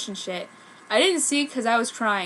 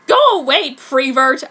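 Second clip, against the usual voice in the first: higher, louder mock snap; angry rejection; 3 semitones higher, 12 dB louder than usual. Goth girl shouting down the prep suitor, hammed-up anger. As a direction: shove him off with your voice.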